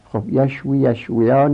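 ordinary talk, nothing marked

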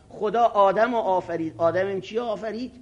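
ordinary delivery

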